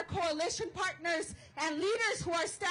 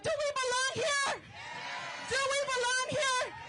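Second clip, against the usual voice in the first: rally call; 6 semitones higher than usual. Real, not acted. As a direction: ask the crowd to answer back. Call to crowd.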